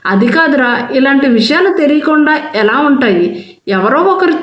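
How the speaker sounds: plain reading voice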